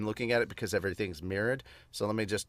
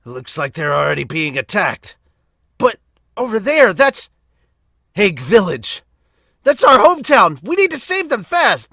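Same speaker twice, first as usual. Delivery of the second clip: high, loud, alarmed hero; frantic high shonen cry; urgent anime hero; 10 semitones higher, 15 dB louder than usual. Acted. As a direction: shout in panic about the hometown. Heroes see their hometown attacked.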